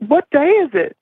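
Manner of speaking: high, alarmed question; disoriented and scared; panicked confusion. Retold or imitated. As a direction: ask it like the ground just vanished.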